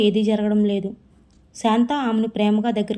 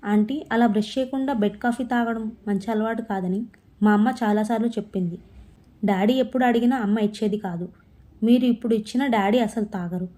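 ordinary delivery, nothing marked

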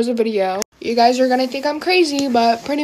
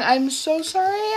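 mock apology; 6 semitones higher than usual. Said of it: "I'm just joking".

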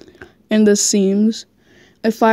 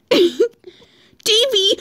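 hammed-up moaning call, pitched up; mock moan of a name; 11 semitones higher than usual. Reading a fanfic aloud to a friend, mock moan. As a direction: moan the name like a parody lover. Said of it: "I moan his name".

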